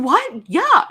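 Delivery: high, loud, startled reply; retold burst of surprise; astonished acceptance. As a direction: jump at the bargain in disbelief. Retelling shock at a low price.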